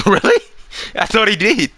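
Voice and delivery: high, loud surprised question; amused disbelief. Real, not acted. Laughing disbelief.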